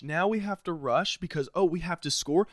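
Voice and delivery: usual speaking voice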